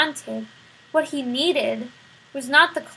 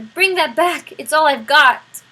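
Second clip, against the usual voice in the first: desperate shout; 3 semitones higher, 10 dB louder than usual. A narrator's reading. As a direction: shout as if losing everything. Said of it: "shouted the prisoner".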